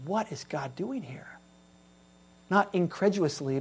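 usual speaking voice

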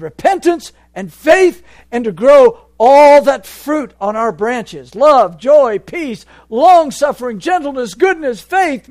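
loud, high fiery preaching; preaching at full force. Acted. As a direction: thunder it from the pulpit.

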